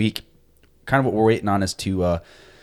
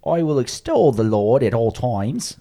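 slow, higher mock English accent, Monty Python style; 4 semitones higher, slower than usual. Podcast host jokingly reads the psalm in a mock English accent. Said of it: "I think you have to read it in an English accent".

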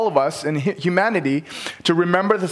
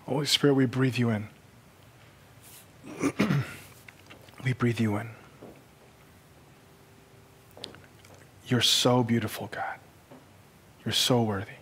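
hushed prayer; 4 semitones lower, 4 dB quieter than usual. Acted. Pastor softly praying over the congregation, hushed. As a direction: pray quietly over the room.

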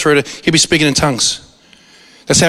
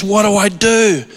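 panicked plea; 6 semitones higher, slower than usual. Retold or imitated. Retold panicked pastor plea.

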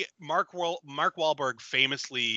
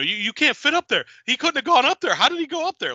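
high, loud panicked disbelief; freaking out; 13 semitones higher, 5 dB louder than usual. Retold or imitated. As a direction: rattle it off in mock panic. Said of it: "Like, he's freaking out".

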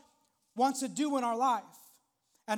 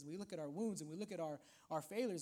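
usual speaking voice

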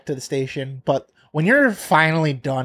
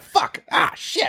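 mock pained cry; 13 semitones higher, slower than usual. Imitating pain loading magazine.

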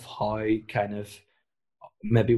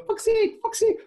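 very high imitation of a two-year-old; toddler voice; 3 dB louder than usual. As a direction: squeak it like a toddler parroting a swear. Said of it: "my two-year-old ... go".

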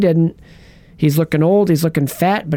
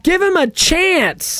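mock pleading; 14 semitones higher, slower than usual. Pleading pun on dog's name.

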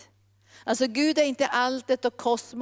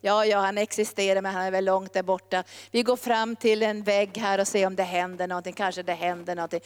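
low, indifferent musing; half-hearted doubt; 4 semitones lower than usual. Acted. Believer going up with little expectation, half-hearted doubt. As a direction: go through the motions without expecting much. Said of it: "om vi tänker".

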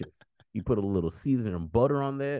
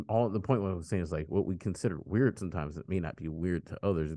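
normal talking voice

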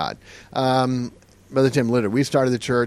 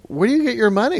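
put-on high-pitched boy pressing the question; higher, puzzled kid voice; insistent child; 10 semitones higher, faster than usual. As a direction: mimic a kid who wants an answer. Child repeats his question insistently.

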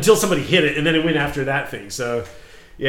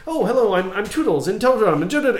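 lightly voiced chirpy gnome, higher; fast gnome voice; 7 semitones higher, 4 dB louder than usual. Game master introducing gnomes to the player, fast and chirpy. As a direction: rattle it off as a cheery gnome. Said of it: "they're speaking very fast".

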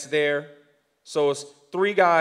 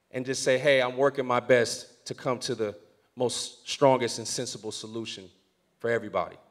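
gentle counsel; 6 semitones lower, 8 dB quieter than usual. Retold or imitated.

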